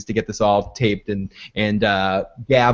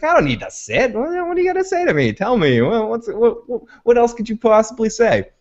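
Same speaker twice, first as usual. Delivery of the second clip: high, fast, giddy mock boast; lightly hammed-up, overjoyed leader who won't sit; mock gloating president; 11 semitones higher than usual.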